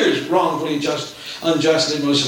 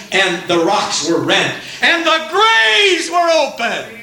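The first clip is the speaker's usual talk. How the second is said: full-force preaching; 6 semitones higher than usual. Acted. Preacher proclaiming Calvary's victory to the church, full-force preaching. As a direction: proclaim each line to the back row.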